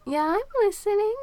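bright teasing high exclaim; playful high exclaim. Acted. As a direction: tease it out high and loud. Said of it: "I'm not just kissing you".

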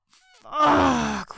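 high, loud, drawn-out groan; groaned exclamation, falling away. A narrator's reading. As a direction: groan the word out forcefully. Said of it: "Will groaned".

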